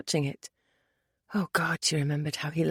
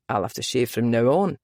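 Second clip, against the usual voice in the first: low Scottish brogue, male lead; deep, easy Scottish man; 7 semitones lower than usual. A narrator's reading. Male character voice.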